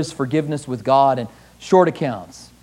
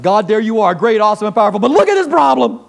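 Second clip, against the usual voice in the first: louder, higher, rushing to the problem; mock dismissive prayer; 4 semitones higher, 5 dB louder than usual. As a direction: brush God aside for your worry. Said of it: "it's like".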